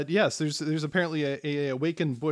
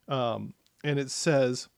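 ordinary talk

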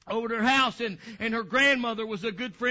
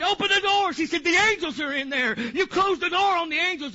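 higher, louder mock-panicked child; imitated panic; 5 semitones higher, 3 dB louder than usual. Little girl pleading at the closed bathroom door, imitated panic. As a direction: cry out urgently, acting a panicking child. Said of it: "she was just panicking".

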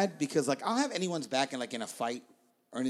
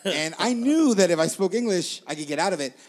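usual speaking voice

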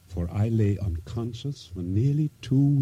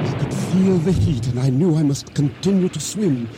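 higher, urgent storytelling as the wave hits; breathless climax; 7 semitones higher than usual. Acted. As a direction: build the panic of being swept under. Narrator's climax of explosion and drowning.

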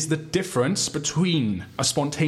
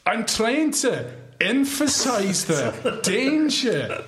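much higher, very slow, laughing; buzzer quote voice; 9 semitones higher, much slower than usual. Acted. Host offering a buzzer quote in an exaggerated voice. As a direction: stretch each word out, fighting laughter.

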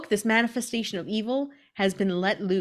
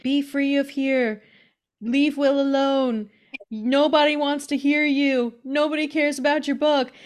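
witch casting spell; 7 semitones higher, 5 dB louder than usual. Acted. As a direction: chant it like a spell. Witch Gertrude casts spells.

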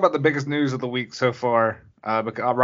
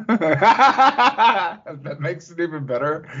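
slow words through real laughter; can barely get it out for laughing; 4 semitones higher, much slower than usual. Real, not acted. Cohost laughs at the good boy remark, laughing slowly.

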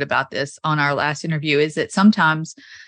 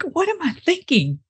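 higher exasperated self-questioning; mock self-scolding; 10 semitones higher than usual. Telling the host how she argued with herself, mock exasperated. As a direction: scold yourself in mock disbelief. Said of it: "I was like".

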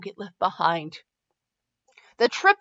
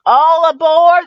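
long, high, loud "all aboard" holler; booming drawn-out train call; conductor's call; 7 semitones higher, 11 dB louder, slower than usual. A narrator's reading. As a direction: call out to the whole platform. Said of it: "the conductor called again".

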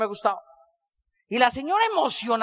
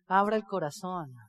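soft urging; 5 semitones lower, 7 dB quieter than usual. Retold or imitated. Lady touching his leg.

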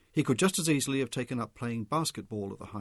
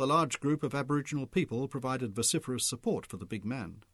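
plain reading voice

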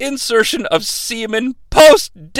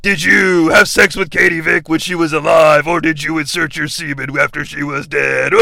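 low, ominous mock wrestler voice; hammed-up low interrogation; Triple H; 5 semitones lower than usual. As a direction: intone it low, grave and accusing. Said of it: "Triple H is saying".

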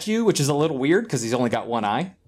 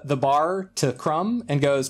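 ordinary delivery

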